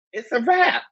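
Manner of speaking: high, gleeful "it's a wrap"; giddy delight. Retold or imitated. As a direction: say it like the best moment ever. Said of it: "I was just like".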